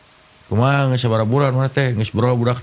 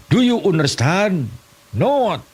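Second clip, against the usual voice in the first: much higher, slow mock English; broken English; 8 semitones higher, slower than usual. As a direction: ham up clumsy English. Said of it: "Bahasa Inggris tapi kepakojot". (bahasa Inggris ngawur, nada tinggi dibuat-buat)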